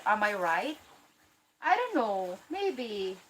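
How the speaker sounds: ordinary talk, nothing marked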